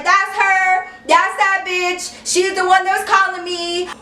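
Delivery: acted heated outburst; getting real loud. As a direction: shout her out as the culprit. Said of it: "I started getting real loud".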